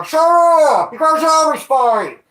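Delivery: high, loud shout from down the street; neighbor yelling. Retold or imitated. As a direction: yell at the noisy couple to shut up. Neighbors yelling at fighting couple.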